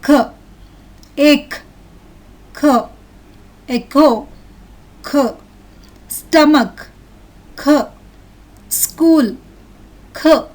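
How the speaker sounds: each word followed by an isolated "kuh" for "k". Shown as a demonstration. Teacher has children listen and repeat, spaced-out hard-k drill. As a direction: drill "Echo", "k", "Stomach", "k", with long pauses. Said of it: "The C H syllable is pronounced as k".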